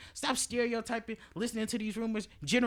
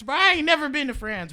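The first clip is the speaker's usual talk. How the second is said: loud, higher disbelieving exclaim; incredulous outburst; 7 semitones higher, 8 dB louder than usual. Real, not acted. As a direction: scoff loudly at the idea. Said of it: "incredulous outburst".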